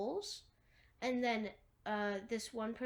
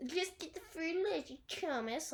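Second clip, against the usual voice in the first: higher-pitched mocking imitation; 6 semitones higher than usual. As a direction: mimic him in a silly, higher voice. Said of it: "like he's mocking Newt".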